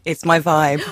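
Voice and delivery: boomy, booming through the mic; loud and boomy, mic too close; muddy boomy voice. Real, not acted. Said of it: "Big boomy voice".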